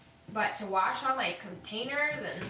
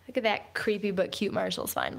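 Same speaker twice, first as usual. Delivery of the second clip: ordinary talk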